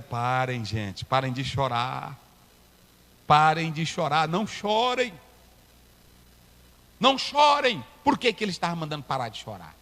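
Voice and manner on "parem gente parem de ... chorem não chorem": gentle levites' plea. Retold or imitated. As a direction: soothe the crowd to stop crying. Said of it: "Aí os levitas disseram".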